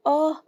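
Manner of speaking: sharp loud cry as if slapped; pained cry. Acted. Cry after slap to face. (صرخة ألم عالية من الكف)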